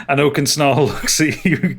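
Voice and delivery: normal talking voice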